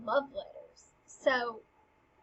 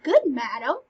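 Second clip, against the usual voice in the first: loud, higher and sassy, brushing the queen off; sassy Polonius; 4 semitones higher, 7 dB louder than usual. Acted. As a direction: talk back to the queen with cheek. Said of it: "Polonius gets really sassy".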